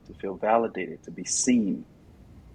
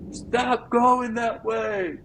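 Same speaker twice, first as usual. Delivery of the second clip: very high, very slow stretched shout; mock yell; 3 dB louder, much slower than usual. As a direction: drag out the warning like calling across a field. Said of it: "even if I have to yell and curse".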